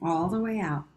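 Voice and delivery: slow, hushed counting, trailing into whispers; guided breathing count. Acted.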